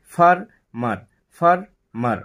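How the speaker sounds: "ফার ... মার" with a clear stop between syllables; two separate beats in "ফার ... মার"; broken into syllables. Shown as a demonstration. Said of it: "ফার বলে থেমে যাচ্ছি".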